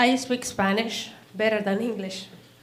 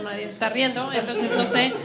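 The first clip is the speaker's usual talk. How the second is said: ordinary delivery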